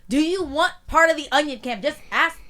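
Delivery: loud, high, exasperated comeback; exasperated retort. Retold or imitated. Co-host retells snapping at housemate over the onion, exasperated. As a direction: let the frustration burst out at him.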